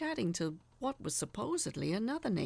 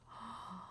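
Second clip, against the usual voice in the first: quick, much softer sharp intake of breath; a startled gasp caught in the throat; soft shocked gasp; 13 dB quieter than usual. A narrator's reading. Sees neighbor start undressing.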